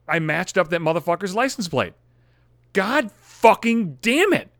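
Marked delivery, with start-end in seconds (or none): 2.7-4.5 slow, loud, high curse; drawn-out curse. Retold or imitated. Finding the lover's license plate, a slow loud high curse. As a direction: stretch the curse out in loud disbelief.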